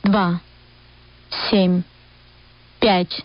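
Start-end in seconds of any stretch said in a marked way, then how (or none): none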